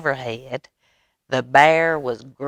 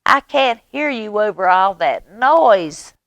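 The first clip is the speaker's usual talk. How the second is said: shouting over noise; 7 semitones higher, 6 dB louder than usual. A narrator's reading.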